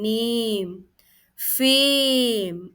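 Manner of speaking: नेम, फेम with long held "ay"; sounding out ए. Shown as a demonstration. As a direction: stretch the vowel in फेम. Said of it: "ए का sound आ रहा है".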